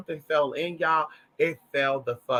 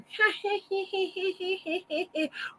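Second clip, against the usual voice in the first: much higher, breathy cackling laugh; high cackle; 14 semitones higher, 7 dB quieter than usual. Real, not acted. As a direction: let a high cackle burst out. Laughing at cashier scene.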